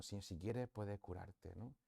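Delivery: soft, hushed plea to the Lord; prayed verse. Acted. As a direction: murmur the verse as a private prayer. Quotes verse as personal prayer.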